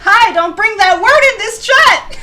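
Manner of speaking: high, loud laughing protest; mock scolding. Real, not acted. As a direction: playfully tell the chat off. Scolding chat jokingly, laughing.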